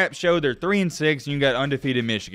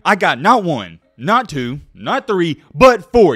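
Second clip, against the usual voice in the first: rising countdown, loud finish; building hype; 3 semitones higher, 7 dB louder, slower than usual. Acted. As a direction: build up to a loud burst on four.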